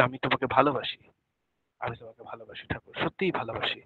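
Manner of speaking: hushed, fast, intimate vow of love; tender prayer. Pretend. Devotee softly confessing love to Thakur in prayer, hushed and tender. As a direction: murmur it softly to Thakur like a secret.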